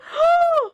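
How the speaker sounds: a sharp, high, loud gasp. Real, not acted. The scene stuns her, a sharp loud gasp. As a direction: suck in air in shock.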